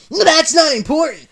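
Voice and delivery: high, loud yell; Sonic yelling. Acted. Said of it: "Sonic yelled".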